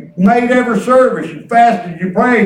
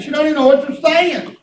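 normal talking voice

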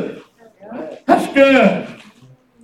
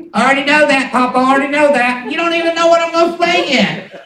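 higher-pitched imitation of a know-it-all child; precocious six-year-old; 5 semitones higher, faster than usual. Granddaughter interrupting her Papa, precocious child voice. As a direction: mimic a little girl cutting grandpa off. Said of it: "She acts like she's been here thirty-five years".

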